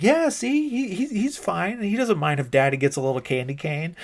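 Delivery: very high, pushy put-on voice; mock coaxing, high and loud at the start; imitated sex worker.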